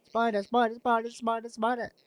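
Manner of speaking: calling the spider in a high strained voice; frantic high calling. Acted. Head calling out for her spider friend, high and frantic.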